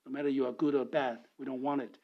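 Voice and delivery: usual speaking voice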